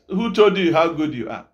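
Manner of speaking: normal talking voice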